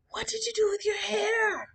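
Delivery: high shocked shout from mom. Retold or imitated. Mom shocked at cut hair.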